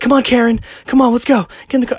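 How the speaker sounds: high, loud mimicked yell; urgent hurrying. Man voices friend calling mother into the car, urgent yell. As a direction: holler like a friend in a getaway rush.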